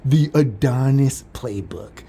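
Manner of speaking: slow, incredulous echo of the phrase; "The Adonis playbook" stretched slowly, mock-quoting their insult; mocking repeat. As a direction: drag out "The Adonis playbook" in disbelief. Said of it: "I was like".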